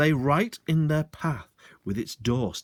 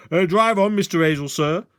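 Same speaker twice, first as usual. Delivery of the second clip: much louder, commanding; commanding policeman; 7 semitones higher, 7 dB louder than usual. Acted. Sergeant shouting orders through the car window. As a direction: order him off like a constable. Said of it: "his most commanding policeman's voice".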